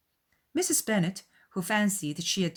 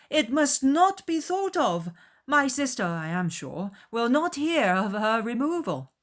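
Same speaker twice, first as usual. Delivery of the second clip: put-on earnest young host; 7 semitones higher, 5 dB louder than usual. A narrator's reading. Bingley assuring the mother, higher and earnest. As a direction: reassure her warmly but firmly.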